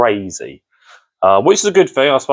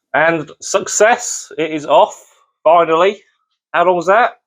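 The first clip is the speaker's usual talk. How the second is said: excited; 7 semitones higher than usual. Real, not acted. Bolt finally comes off, relief.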